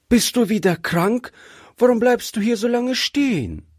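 put-on high-pitched little girl questioning mama; child calling out. A narrator's reading. Gretchen asks whether her mother is ill, child calling out. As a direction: ask like a worried child, pitched well up. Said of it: "rief Gretchen".

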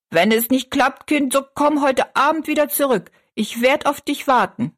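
high-pitched call into the distance; calling after. A narrator's reading. Old woman on the doorstep to departing girl, calling after her. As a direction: call out after someone leaving. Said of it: "rief sie noch hinterher".

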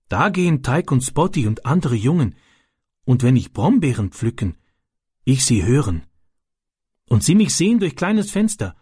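a hint of a young foreign boy telling his worry; Ram's childlike broken German.

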